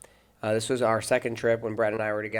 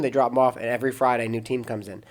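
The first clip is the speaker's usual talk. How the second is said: ordinary delivery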